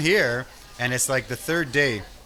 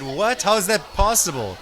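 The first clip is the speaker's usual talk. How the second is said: higher, louder shocked What; shocked disbelief; 6 semitones higher, 4 dB louder than usual. Retold or imitated. Retelling his shock to a friend's news, higher and louder. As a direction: react like you can't believe it.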